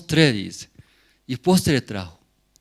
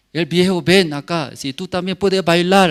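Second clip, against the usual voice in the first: pastor calling; 6 semitones higher, 7 dB louder than usual. Retold or imitated. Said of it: "así me dijo".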